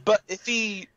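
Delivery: usual speaking voice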